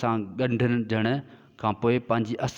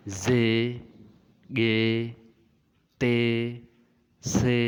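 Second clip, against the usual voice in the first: each letter name said slowly and clearly, one by one; letter recitation; much slower than usual. Shown as a demonstration. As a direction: call out the Sindhi letters like a teacher drilling a class. Recites letter names in a row.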